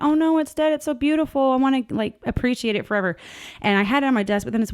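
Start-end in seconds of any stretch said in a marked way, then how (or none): 0.0-3.2 higher, lightly mock-dismayed; quoting her own dismay over the dead butterfly. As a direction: act out your past self fussing. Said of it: "And I was like".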